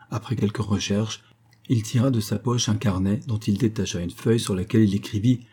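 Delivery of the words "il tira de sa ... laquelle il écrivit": ordinary delivery, nothing marked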